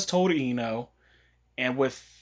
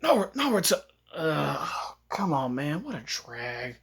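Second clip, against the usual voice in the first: lightly acted reluctant complaint; Shikamaru; 3 dB quieter, slower than usual. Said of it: "and Shikamaru was like".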